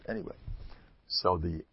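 ordinary talk, nothing marked

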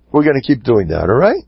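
ordinary delivery, nothing marked

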